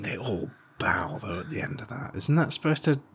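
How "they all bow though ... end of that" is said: unvoiced hushed aside; whispered aside to cohost. Real, not acted. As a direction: whisper it under your breath. Aside about the bow scene.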